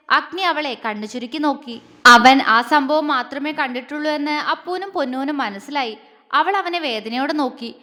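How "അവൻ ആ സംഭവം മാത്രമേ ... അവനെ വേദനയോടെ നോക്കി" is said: ordinary delivery, nothing marked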